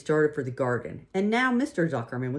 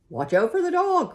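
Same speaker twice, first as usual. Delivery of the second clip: very high, loud cry; cried warning; 7 dB louder than usual. A narrator's reading. Sheep warning Wilbur of the dog, high loud cry. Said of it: "cried the sheep".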